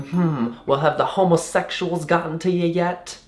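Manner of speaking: high, softened Southern lady voice; Southern accent. Retold or imitated. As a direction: imitate a prying Southern woman. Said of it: "really bubbly and talkative and Southern".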